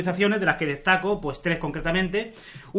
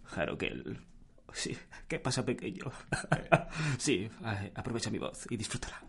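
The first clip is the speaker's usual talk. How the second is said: deep, soft sultry voice; low and hushed, like a seductive narrator; velvety voice; 6 semitones lower, 13 dB quieter than usual. Pretend. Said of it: "con esta voz aterciopelada".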